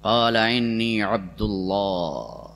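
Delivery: slow, deep-set proclamation; booming proclamation. Retold or imitated. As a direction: declare slowly like a voice echoing. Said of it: "زور سے عیسیٰ علیہ السلام شروع ہوئے". (بچے کی گونجتی اعلانیہ آواز)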